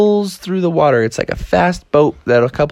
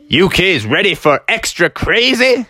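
higher, rousing put-on voice; hyping up kids; 6 semitones higher than usual. Mister Chameleon dares the kids on the tube, rousing hype. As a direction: rev them up for a wild ride.

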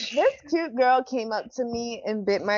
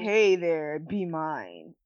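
contrasting creepy voice; 8 semitones lower, much slower than usual. Shown as a demonstration. Co-host mimics the approach she would pepper spray, low creepy voice. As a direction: drop the pitch and let it sink, like a creep. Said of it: "the difference in voices and the difference in".

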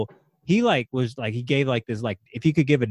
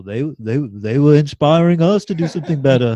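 hammed-up British accent, growing louder; 3 semitones higher, 8 dB louder than usual. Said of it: "You should talk like that".

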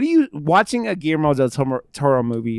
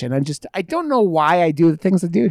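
put-on British accent; 4 semitones higher, 3 dB louder, faster than usual. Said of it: "Now I sound British".

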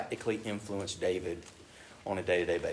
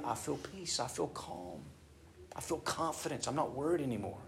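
softer, gentle, reassured; softly voiced peace; calm prayer; 2 semitones higher, 7 dB quieter than usual. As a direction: let the calm settle into the words.

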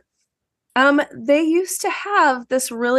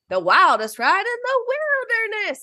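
higher quote lifting at the end; rising Disney World version; intonation goes up; 13 semitones higher than usual. Retold or imitated. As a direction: let the line climb on wilderness. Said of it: "the one at Disney World says".

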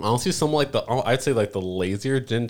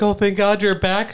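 high, bright-voiced relief; a much higher mock girl's voice; mock relieved girl; 12 semitones higher, 5 dB louder than usual. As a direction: squeal it as the relieved party member. Voicing relieved isekai companions.

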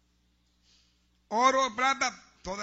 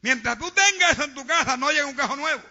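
loud, high fiery preaching; loud revival preacher; preaching at full force; 6 semitones higher, 9 dB louder than usual. Acted. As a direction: thunder it from the pulpit.

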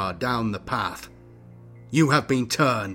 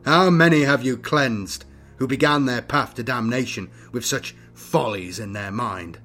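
louder accusing question; contemptuous challenge; 2 semitones higher, 7 dB louder than usual. A narrator's reading. Young Inquisitor stepping back from his rival, contemptuous. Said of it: "contempt written across his face".

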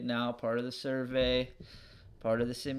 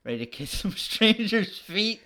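laughing; 7 semitones higher, 4 dB louder than usual. Real, not acted. Joking, laughs mid-line.

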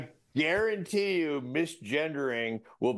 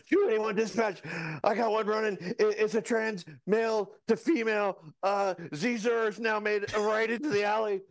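higher-pitched mock police dispatch call; flustered traffic cop; 6 semitones higher than usual. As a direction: stammer a suspect description to dispatch, pitched up. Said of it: "You're a cop on a traffic stop".

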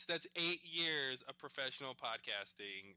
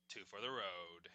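lower, grand title announcement; dramatic announcer; 6 semitones lower, 5 dB quieter than usual. Acted. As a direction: announce it like a big movie reveal. Dramatic title reveal after "wait for it.

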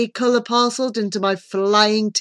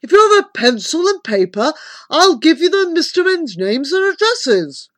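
rumbling uncle; 8 semitones higher, 4 dB louder than usual. A narrator's reading. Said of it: "the voice rumbled in my ear".

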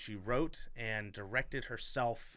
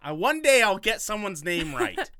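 louder, playful exasperation; joking loud; 6 semitones higher, 11 dB louder than usual. Real, not acted. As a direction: proclaim it as a silly new rule. Joking after name mistake.

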